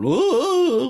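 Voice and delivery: very high, loud cartoonish ooh; Jughead-style whoop; mock ooh. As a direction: squeal like a cartoon sidekick.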